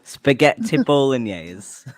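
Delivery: slow, laughing fake British accent; mock British accent. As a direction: stretch Spaghetti bolognese out in a mock British accent. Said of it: "You sound like crazy people".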